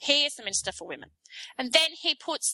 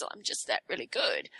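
lower, softer smug brag; smug self-praise; 7 semitones lower, 6 dB quieter than usual. Retold or imitated. Rudd bragging to the world, lower smug purr. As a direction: buff the nails and purr it out smugly. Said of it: "buffs his fingernails in his shirt and goes".

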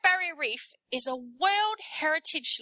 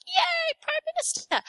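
put-on much higher, excited kid's squeal; childlike cheer. Voters cheering Gillard's rise, high childlike squeal. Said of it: "and we all like".

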